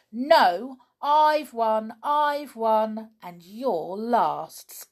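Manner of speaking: high, loud triumphant cry. A narrator's reading. Ronnie crows at Donald that he has won. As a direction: crow about winning. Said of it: "cried Ronnie".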